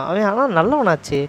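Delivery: usual speaking voice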